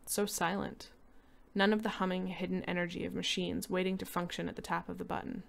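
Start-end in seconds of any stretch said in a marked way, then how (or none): none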